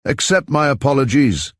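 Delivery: put-on light German accent, lifted and laughing; mocking apology with a hint of German accent. A narrator's reading. Von Inwald leaving, mocking Mr. Pierce in a light German accent. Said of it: "one with a strong German accent".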